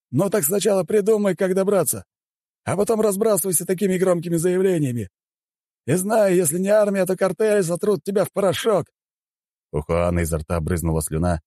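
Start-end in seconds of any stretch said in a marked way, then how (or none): 0.1-8.9 high-pitched scornful character voice; worked-up leader jeering high; agitated, taunting Juan. Acted. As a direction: threaten mockingly in a high, agitated voice.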